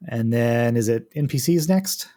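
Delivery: usual speaking voice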